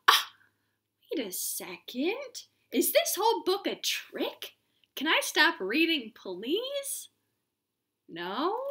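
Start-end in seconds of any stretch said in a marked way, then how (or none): none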